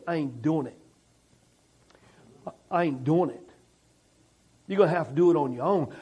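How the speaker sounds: low, quiet, dismissive God voice; a weary God shutting it down, low and soft; voice of God refusing. Acted.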